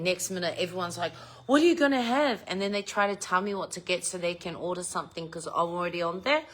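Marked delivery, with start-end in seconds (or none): 1.5-2.4 higher, keen question; raised-pitch eager ask; mock eager housemates. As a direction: imitate the family suddenly interested. Housemates asking her food order.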